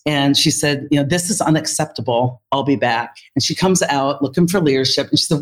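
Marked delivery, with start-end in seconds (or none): none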